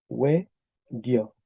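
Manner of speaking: "we dio" with dio as one syllable, dyo; glide formation. Shown as a demonstration. Teacher applies rule five for class, glide formation. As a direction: say "we dio" as two beats, we and dyo. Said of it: "Con P cinco".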